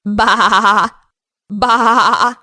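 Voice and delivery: bleating baa baa; cartoon sheep bleating. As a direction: bleat like a little lamb. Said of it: "the animal that makes the sound".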